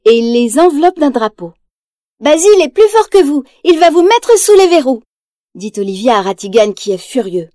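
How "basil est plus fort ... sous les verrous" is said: put-on much higher, louder child's taunt; a small girl standing up to the villain; defiant little girl. Olivia taunting Ratigan, high defiant child voice.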